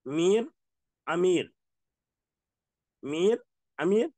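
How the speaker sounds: میر and امیر with a long ee; teacher drilling میر and امیر; ya maroof. Shown as a demonstration. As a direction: read میر then امیر, alif added in front. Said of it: "الف لگا دو تو امیر ہو گیا".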